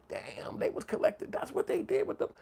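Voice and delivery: high, breathless whispered amazement; stunned whisper. Retold or imitated. Kid watching rich folks on TV, whispered awe. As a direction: gasp it out in awe. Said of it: "you sitting back like".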